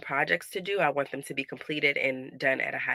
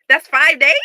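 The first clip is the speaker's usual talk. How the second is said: much higher and louder shocked cry; 10 semitones higher, 13 dB louder than usual. Real, not acted. Mom realizing the whole week is lost, shouting in shock. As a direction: cry out in disbelief. Shocked repeat of five days.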